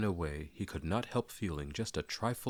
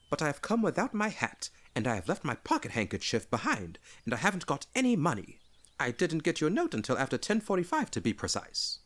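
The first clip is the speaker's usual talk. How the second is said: much higher, louder, fast babbling hobbit; Bilbo flustered; 10 semitones higher, 3 dB louder than usual. Acted. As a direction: tumble out excuses breathlessly.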